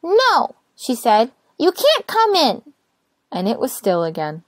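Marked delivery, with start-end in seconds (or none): 0.0-0.5 high, slow and firm refusal; little rosebud refusing to open the door, high and stubborn. Acted. 1.6-2.7 high, slow and firm refusal; little rosebud refusing to open the door, high and stubborn. Acted.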